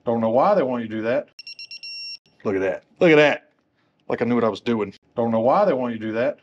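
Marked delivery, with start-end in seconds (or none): 3.0-3.5 punched-up, loud self-admiration; louder repeat. Real, not acted. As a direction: show it off much louder. Louder repeat, admiring own work.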